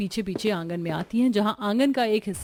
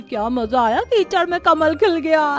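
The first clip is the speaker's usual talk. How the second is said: the aunt taunting the muddy bride, laughing; mocking laughter; 3 semitones higher than usual. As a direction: sneer while laughing at her. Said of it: "हंसी उड़ाती है".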